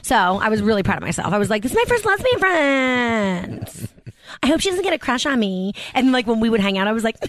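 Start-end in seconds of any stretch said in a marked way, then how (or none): 1.6-5.7 high, drawn-out mock squeal; self-mocking, sing-song delight; gushing pride. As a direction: gush it slowly, pitched up. Retelling own gushing pride.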